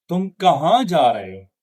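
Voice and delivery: peak on kahan, then let the pitch drop to the end; WH-question falling away after kahan; falling question intonation. Shown as a demonstration. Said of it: "falling intonation".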